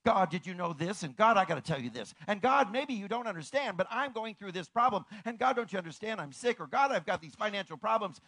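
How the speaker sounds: loud, rushed complaining to God; hammed-up nagging prayer; mock busy prayer. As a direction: rattle off worries at God. Imitating busy chattering prayers.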